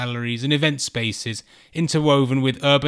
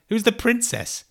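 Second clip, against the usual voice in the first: surprised; 10 semitones higher than usual. Retold or imitated. Confused reply to embassy call.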